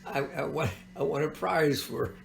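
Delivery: normal talking voice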